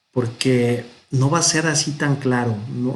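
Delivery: usual speaking voice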